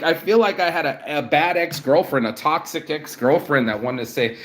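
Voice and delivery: usual speaking voice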